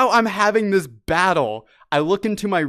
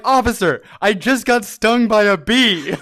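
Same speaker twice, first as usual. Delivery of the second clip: louder, higher frantic plea; desperate plea; 4 semitones higher, 6 dB louder than usual. Retold or imitated. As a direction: beg the officer, frantic.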